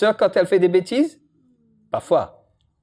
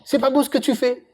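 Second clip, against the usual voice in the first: mock scolding; 8 semitones higher than usual. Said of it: "Non, jamais, il crie pas".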